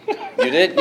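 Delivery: high, laughing surprise; surprised. Retold or imitated. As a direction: squeal it out, delighted. Own surprised reply, laughing.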